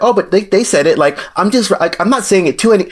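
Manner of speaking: mock defensive protest.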